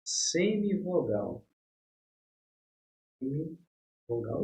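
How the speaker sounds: semivogal drawn out, each syllable slow; slow emphasis. Shown as a demonstration. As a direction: spell out semivogal very slowly. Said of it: "vogal e uma semivogal". (semivogal dito bem devagar)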